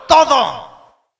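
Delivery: TODO shouted full force; now really shout it. Shown as a demonstration.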